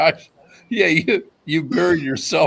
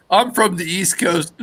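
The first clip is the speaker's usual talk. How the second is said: ordinary talk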